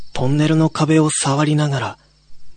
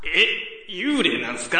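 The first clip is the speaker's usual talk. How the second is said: laughing guy; 5 semitones higher, 6 dB quieter than usual. Acted. Young man jokes.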